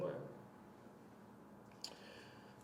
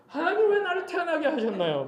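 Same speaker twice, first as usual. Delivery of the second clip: mock complaint; 9 semitones higher than usual. Job questions God over his birth, high mock complaint. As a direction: put on a high-pitched grievance, falling at the end. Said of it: "뭐라고 불평해요".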